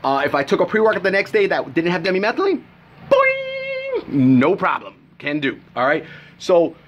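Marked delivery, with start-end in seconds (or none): 3.1-4.1 very high cartoon "boing"; a springy cartoon noise, way up; mock boing. Sound effect for success.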